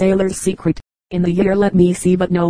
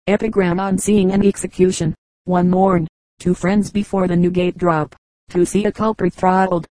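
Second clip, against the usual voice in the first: ordinary delivery, nothing marked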